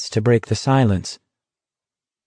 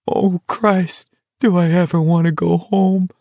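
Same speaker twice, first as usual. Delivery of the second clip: high, thin, plaintive voice; forlorn, high-pitched longing; very quiet plea; 8 semitones higher than usual. A narrator's reading. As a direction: moan it small, desperate for home. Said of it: "very quietly".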